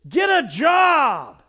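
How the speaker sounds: loud, slow shout; shouted jeer. Retold or imitated. Next driver shouts at sign holders, loud slow jeer. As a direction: bellow it from a passing car. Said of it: "next car came by and says".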